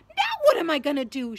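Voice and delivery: high, loud, shrill wail. A narrator's reading. Hermione finds herself locked out of the tower, shrill. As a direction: cry it out shrilly in alarm. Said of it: "she asked shrilly".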